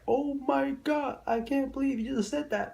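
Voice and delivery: playing the girl, stunned and squeaky; mock shocked girl. Retelling her reaction, high mock gasp. Girl's shocked reply in story.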